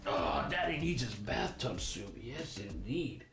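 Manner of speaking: lazy dad voice. Acted. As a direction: savor it like a pampered dad. Voicing lazy dad with robot.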